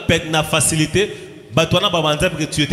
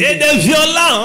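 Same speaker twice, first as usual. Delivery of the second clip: high, slow, loud preaching cry; the preacher's climax shout; 10 semitones higher, 4 dB louder, slower than usual. Real, not acted. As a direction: hammer out each word to the crowd.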